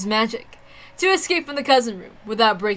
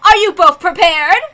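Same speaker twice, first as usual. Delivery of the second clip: higher, louder formal announcer figure; ceremonial host voice; 6 semitones higher, 9 dB louder than usual. Acted. Host asking the duelists if ready, in a grand voice. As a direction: announce the duel rules with grandeur.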